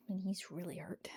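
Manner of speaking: whispered, hurting; soft whispered complaint; pained whisper. Real, not acted. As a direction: murmur it in pain.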